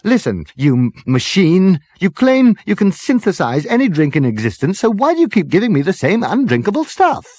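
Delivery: high, loud, fed-up rant at a machine; exasperated Arthur. Acted. Arthur berating the drinks machine, exasperated and loud.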